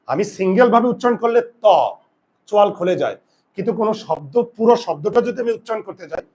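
usual speaking voice

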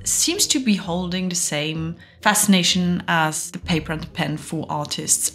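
usual speaking voice

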